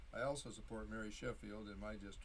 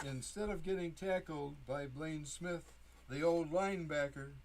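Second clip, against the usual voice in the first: poetry reading; 5 semitones higher than usual. Acted. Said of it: "this poem that I call No Fun".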